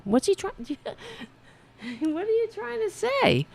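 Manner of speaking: high, slow playful question to the pet. Real, not acted. Asking the cat what it wants, high slow coo.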